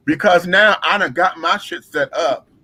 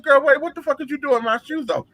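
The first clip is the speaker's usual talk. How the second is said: indignant confrontation; 9 semitones higher than usual. Retold or imitated. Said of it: "I said".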